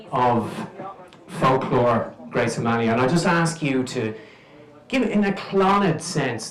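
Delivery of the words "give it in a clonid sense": ordinary talk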